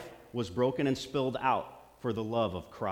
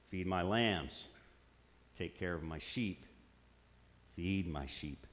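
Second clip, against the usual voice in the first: gentle Jesus voice; 7 semitones lower, 7 dB quieter, slower than usual. Acted. Voicing Jesus, soft and low.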